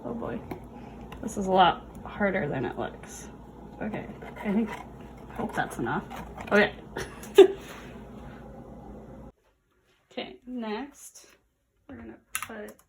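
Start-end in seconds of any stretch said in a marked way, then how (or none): 3.8-6.0 muttered. Real, not acted. Muttering during experiment. 6.8-9.3 laugh. Real, not acted. Experiment goes wrong.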